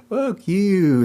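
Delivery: high-pitched mock-angry swear; angry curse. Shown as a demonstration. Another demo of an angry curse, high mock anger. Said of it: "Isn't that an angry curse?".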